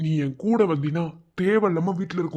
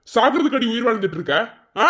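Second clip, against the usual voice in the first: higher, louder scolding snap; a friend scolds her for talking of death, angry; 6 semitones higher, 5 dB louder, faster than usual. Acted. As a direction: tell her off sharply. Friend scolding crying woman.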